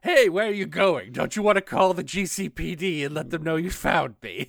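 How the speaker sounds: loud, high-pitched villain call-out; high, theatrical clown-villain voice; Joker. Pretend. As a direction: taunt a fleeing passer-by, loudly. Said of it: "I'm the Joker".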